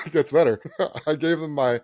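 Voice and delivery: usual speaking voice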